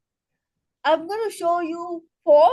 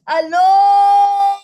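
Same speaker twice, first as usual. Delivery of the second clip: loud, high, very drawn-out aloha; ringing sustained celebratory call; celebration laughter; 5 semitones higher, 9 dB louder, slower than usual. Shown as a demonstration. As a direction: hold the middle vowel long, then release a ha. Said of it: "celebration laughter".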